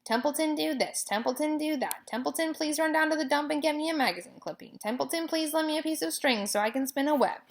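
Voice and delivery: sarcastic, mocking rat; disgusted. A narrator's reading. Rat mocks the pig's requests in disgust. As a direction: sneer at being used again. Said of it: "he said in disgust".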